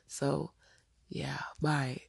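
softly whispered sign-off; whispered goodbye. Real, not acted. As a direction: breathe the goodbye out. Signing off the episode.

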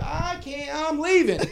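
high, loud retold outburst; frantic insistence. Storyteller insists he is leaving, high loud outburst. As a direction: cry it out high, half laughing.

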